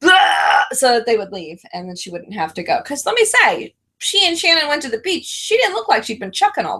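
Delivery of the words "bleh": mock retching sound; fake barf. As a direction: gag on purpose for show. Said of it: "her standing at the door going".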